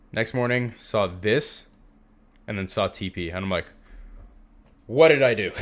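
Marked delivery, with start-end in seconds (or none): 4.9-5.5 mock dismay. Retelling his shocked reaction next morning.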